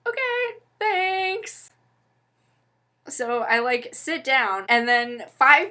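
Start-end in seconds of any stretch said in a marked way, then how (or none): 0.1-1.7 much higher, sugary fake-polite okay, thanks; sarcastic thanks. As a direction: thank her with biting fake sweetness. Kicked off, sarcastic reply.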